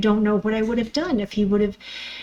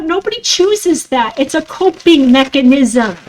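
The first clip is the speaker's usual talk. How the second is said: ordinary talk, nothing marked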